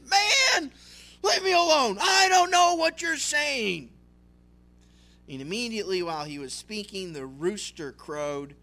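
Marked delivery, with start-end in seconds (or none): 0.1-3.9 high, loud, pleading shout; desperate shouted denial. Acted. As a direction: cry out to be left alone. Peter's third denial.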